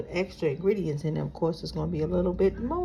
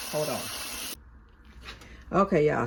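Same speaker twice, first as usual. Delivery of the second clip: ordinary talk, nothing marked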